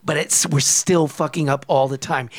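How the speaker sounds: normal talking voice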